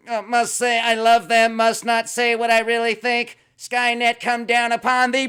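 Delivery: high, loud mock android voice building to a shout; a rogue AI unmasked, hammed-up and booming; evil robot Sophia. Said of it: "After she said".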